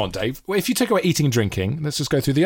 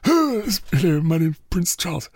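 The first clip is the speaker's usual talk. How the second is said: high, strained royal impression; gasp, then strain the words out high; Prince Charles; 6 semitones higher, slower than usual. Debuting an impression for co-host, amateur royal voice. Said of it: "This is my first ever impression".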